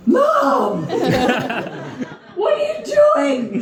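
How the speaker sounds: high voice cracking up on Mom with a laugh. Retold or imitated. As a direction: squeak it out in disbelief, laughing. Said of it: "I remember my voice cracked".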